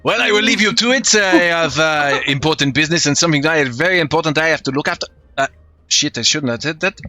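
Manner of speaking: put-on lightly French-accented, easygoing mercenary; a breezy hint of a French rogue; French swashbuckler. The mercenary chats with Thomas on deck in French accent.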